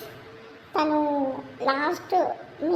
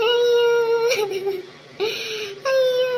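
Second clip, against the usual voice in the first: much higher, louder wailing cry; 6 semitones higher, 4 dB louder, slower than usual. Acted. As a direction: wail out loud in grief. Widow's final wail.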